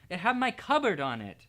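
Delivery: said angrily. A narrator's reading. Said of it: "said Harry angrily".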